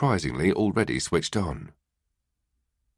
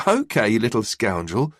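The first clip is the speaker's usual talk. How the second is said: ordinary delivery, nothing marked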